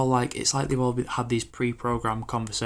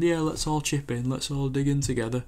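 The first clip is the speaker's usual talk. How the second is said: mock cheery recruiter; 4 semitones higher than usual. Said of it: "people saying".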